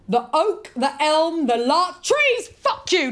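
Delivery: high, loud recital bursting into contempt; impatient poem reading. Acted. Impatient reader rejecting her first poem, mock-furious. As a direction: build to a scornful mock outburst.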